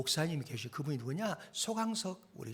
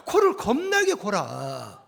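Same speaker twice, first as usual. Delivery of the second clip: slow, loud Jeolla drawl; Jeolla dialect; 4 semitones higher, 11 dB louder, slower than usual. Real, not acted. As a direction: lean hard into the Jeolla dialect. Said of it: "사투리 쓰지 말아야겠는데". (전라도 사투리로 힘주어 느리게)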